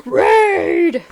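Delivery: high, loud announcer yell of a brand name; cockroach commercial voice. Pretend. Co-host parodying the cockroach ad, hammed-up announcer shout. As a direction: bark it like a cheesy bug-spray ad. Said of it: "you had that voice down perfectly".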